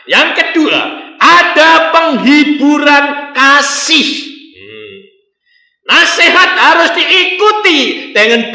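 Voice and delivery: loud, rising preacher's exhortation; fervent preaching. Acted. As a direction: drive the point home to the congregation. Preaching at full force. (khotbah berapi-api dengan suara keras)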